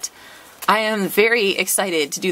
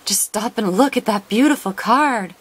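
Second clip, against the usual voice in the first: ordinary talk, nothing marked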